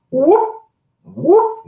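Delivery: high whooping call, loud; two loud rising whoops into the woods; Bigfoot whoop call. Retold or imitated. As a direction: cup your voice and whoop like Bigfoot. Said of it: "whoop is the way Bigfoot communicates".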